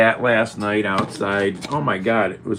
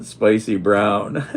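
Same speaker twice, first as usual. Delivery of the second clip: laughing gleefully; 2 semitones higher, 4 dB louder, much slower than usual. Real, not acted. Gloating about the mustard to the camera, laughing. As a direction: relish it, then break up laughing.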